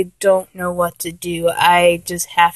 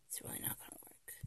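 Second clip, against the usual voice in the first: whispered aside; 40 dB quieter, much faster than usual. Acted. Whispered aside after complaint.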